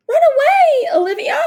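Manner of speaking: very high, loud panicked scream. A narrator's reading. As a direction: scream a warning to her daughter. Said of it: "the Duchess screamed".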